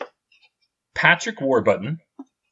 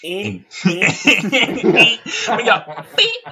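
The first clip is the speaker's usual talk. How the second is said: laughing retry; 8 semitones higher than usual. Shown as a demonstration. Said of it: "Can you say in twenty seventy-seven again".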